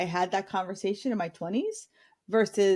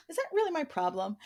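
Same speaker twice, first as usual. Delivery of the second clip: higher-pitched, softly whined question; whiny voice; 6 semitones higher, 4 dB quieter than usual. Shown as a demonstration. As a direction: whine it as a mock complaint. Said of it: "say it in the whiny voice".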